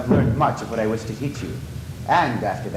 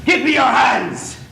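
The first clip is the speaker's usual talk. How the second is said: loud, high, slow bellowed order; furious shout; 9 semitones higher, 5 dB louder than usual. Acted. Eric to Christine demanding her hands, furious shout.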